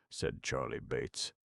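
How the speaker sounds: ordinary delivery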